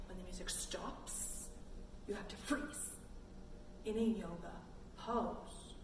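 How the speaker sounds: soft unvoiced whisper of the rule. Shown as a demonstration. Teacher whispering the freeze rule to kids. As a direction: hush it like a secret. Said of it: "whispers freeze game rule".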